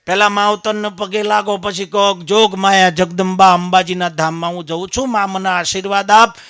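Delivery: loud, urging preacher's voice; preaching at full force. Acted. Preacher telling pilgrims to bow to their parents first, loud urging. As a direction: press the crowd to seek blessing.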